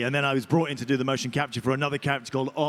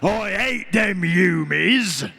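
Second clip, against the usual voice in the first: slow, louder Cockney orc bellow with dropped aitches; 4 semitones higher, 3 dB louder, much slower than usual. Shown as a demonstration. Actor translating the orc's script for the audience, Cockney orc bellow. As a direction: roar it out as a weird Cockney orc. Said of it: "in, like, a weird Cockney accent".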